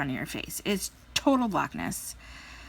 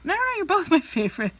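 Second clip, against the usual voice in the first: caught parent backpedaling; 10 semitones higher, 4 dB louder than usual. Acted. Caught by the other child.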